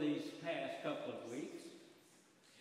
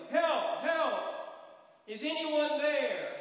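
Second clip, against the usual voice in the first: loud high desperate cries; yelling for help; 9 semitones higher, 8 dB louder, much slower than usual. Acted. Man dangling from a branch yells for help. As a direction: shout for help from a cliff branch. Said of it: "he started yelling".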